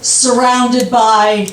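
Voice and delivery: slow daydreaming husband, imitated with long pauses; unhurried retold reverie; dreamy daydreaming. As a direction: drift slowly from phrase to phrase.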